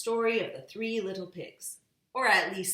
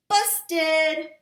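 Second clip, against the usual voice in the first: put-on baby voice; 10 semitones higher, 12 dB louder than usual. A narrator's reading. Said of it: "said Baby Blair".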